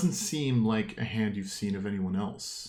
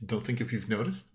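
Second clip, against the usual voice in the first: usual speaking voice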